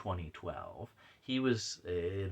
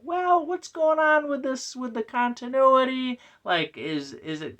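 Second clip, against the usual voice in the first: much higher, louder put-on voice; mock fussy questioning; 8 dB louder than usual. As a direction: pitch it way up as a pestering fan. Replays own fussy question.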